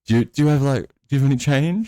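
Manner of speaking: high-pitched, halting imitation of a driver; mock timid driver. Driver asking the unhappy customer for change, mock timid.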